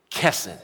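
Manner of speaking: Kesed pitched low, its ending trailing off; first-syllable accent. Shown as a demonstration. Preacher leading the congregation, Kesed accented on the first syllable. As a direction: stress the first syllable of Kesed, let the second fade. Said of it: "the accent's on the first syllable".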